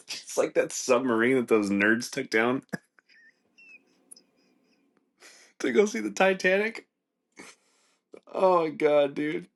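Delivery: laughing through the words, voice climbing high. Real, not acted. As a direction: giggle it out, pitch rising at the end. Laughing while mocking submarine trip.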